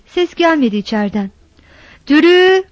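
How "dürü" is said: loud call of her name, pitched higher; calling loudly for the missing wife. A narrator's reading. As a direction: shout her name across the yard. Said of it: "diye bağırdı".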